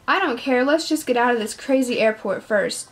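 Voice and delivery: ordinary delivery, nothing marked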